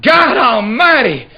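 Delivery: high, loud, drawn-out cry of ecstasy; yelled out. Acted. A woman crying out to God in bed, loud and high. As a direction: let it burst out of her, slow and very high. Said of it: "and she yelled out".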